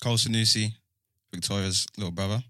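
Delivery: ordinary talk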